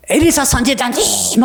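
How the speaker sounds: calling out. Retold or imitated. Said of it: "하고 부르고 들어오는 거예요".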